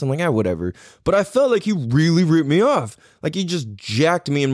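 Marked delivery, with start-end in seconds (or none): 0.2-0.7 shrugging it off. Retold or imitated. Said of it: "normally after a few minutes".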